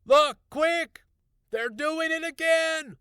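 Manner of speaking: very loud high frantic shouting; excited shout. A narrator's reading. Husband shouting as the tomatoes rise again, excited shout. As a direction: yell urgently, astonished. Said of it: "shouted excitedly".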